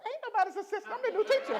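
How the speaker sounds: high, loud indignant retort with a laugh; sassy comeback. Retold or imitated. Teacher snaps back at the secretary, high and loud. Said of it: "I was like".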